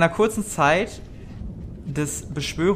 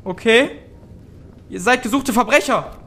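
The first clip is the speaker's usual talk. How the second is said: frightened innkeeper; faster than usual. Acted.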